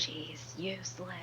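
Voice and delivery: very soft eerie voice. Pretend. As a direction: ghostly hush into his mind. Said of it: "make my voice sound really eerie".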